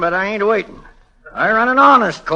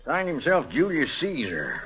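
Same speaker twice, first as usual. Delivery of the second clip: ordinary talk, nothing marked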